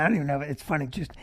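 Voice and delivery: usual speaking voice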